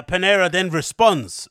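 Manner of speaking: ordinary talk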